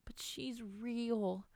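put-on soft, high, drawn-out child's plea; tearful protest. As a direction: insist slowly, like a frightened little girl. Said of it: "Maria Lucia protested".

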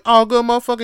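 high, loud hype greeting; hyped greeting. Retold or imitated. Greeting a friend on the street, loud hype. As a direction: dap them up shouting it. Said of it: "you goes like".

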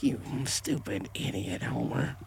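slow, hushed cartoon wife impression; mock disdain in a soft put-on voice; Marge Simpson. Wife with no sympathy for husband, mock cold contempt. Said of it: "Marge is just like".

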